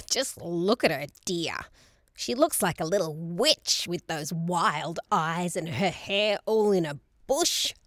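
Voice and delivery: sighing, disapproving grandmother voice; sniping grandmother. A narrator's reading. Grandmother mocking grandchild's wild looks, sniping. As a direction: snipe about the child as if she weren't there.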